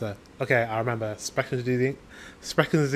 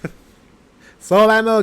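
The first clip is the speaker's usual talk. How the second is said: laughing; 6 semitones higher, 7 dB louder, much slower than usual. Real, not acted. Laughing at his limited German.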